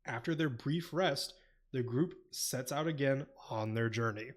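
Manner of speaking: calm, soft summary read; synopsis reading. Acted. Reads chapter synopsis.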